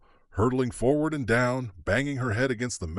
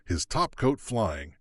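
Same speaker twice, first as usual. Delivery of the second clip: ordinary delivery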